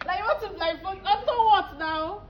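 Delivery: indignant protest. Real, not acted. Woman confronting man about the blindfold, indignant. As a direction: protest in high, offended disbelief.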